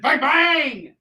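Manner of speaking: high, loud mock-American gun noises; cartoon American "bang, bang"; hammed-up American accent. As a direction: shout it like a caricature American gunslinger. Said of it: "and I just said".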